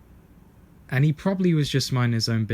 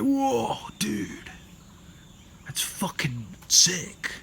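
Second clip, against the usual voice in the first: high, drawn-out mock amazement; impressed dude; 9 semitones higher, much slower than usual. Bystander cheering on the spoon protester, mock awe. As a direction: imitate an awestruck dude, slowing down.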